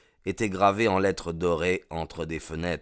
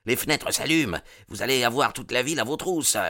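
higher and fast, alarmed; urgent warning; 6 semitones higher, faster than usual. Acted. Lookout warning the gang the town is waking, fast and alarmed. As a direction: rush the words, danger coming. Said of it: "cria l'homme de faction".

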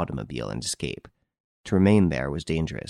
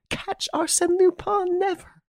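higher, slow and incredulous lady; scoffing lady; slower than usual. Acted. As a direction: disbelief drawn out slowly.

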